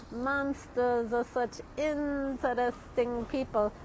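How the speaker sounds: slow, drawn-out cartoon character voice; cartoon impression. Fan quoting a favorite classic cartoon, slow put-on voice. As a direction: stretch it out like a cartoon quote. Quotes Bugs Bunny cartoon line.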